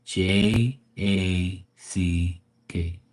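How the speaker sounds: "J A C K" spelled letter by letter, very slow and soft; spelled out slowly. Retold or imitated. Vlogger spelling the name out for a clueless chatter, slow and patronizing. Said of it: "the guy's name is Jack".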